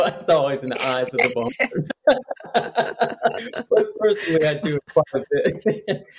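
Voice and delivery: talking through real laughter; laughing. Real, not acted. Interviewee joking about their own self-assessment, laughing. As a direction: chuckle your way through the words.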